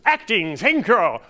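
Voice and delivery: slow, loud, high histrionic boom; Master Thespian catchphrase. Retold or imitated. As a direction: ham it up to the nth degree. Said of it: "his catchphrase was".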